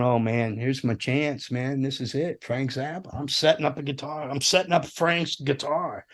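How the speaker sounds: soft, understated private awe; hushed inner thought. Retold or imitated. Guitar tech telling himself this is his chance, hushed. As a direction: think it to yourself, voice dropped.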